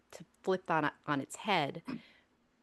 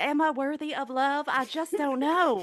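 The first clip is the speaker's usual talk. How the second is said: put-on Southern accent; 7 semitones higher than usual. Host mock-agonizes over being worthy of love, hammed-up Southern accent. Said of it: "this is where I break into my Southern accent".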